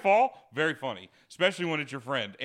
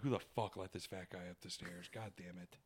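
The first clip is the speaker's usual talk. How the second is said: muttered complaint; 8 semitones lower, 17 dB quieter than usual. Retold or imitated. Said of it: "She's like".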